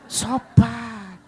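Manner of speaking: "Sopan" burst out loud and certain; the thankful thought shouted out loud; emphatic inner cry. Retold or imitated. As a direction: belt "Sopan" with delight. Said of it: "Dalam hati".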